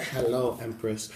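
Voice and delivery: low, soft mock greeting; imitating the DJ's greeting. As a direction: drop it low like a smooth greeter. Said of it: "He just said, he said".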